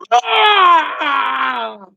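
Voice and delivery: loud high mock scream; mock shriek. Streamer mocking a frantic viewer with a loud high shriek.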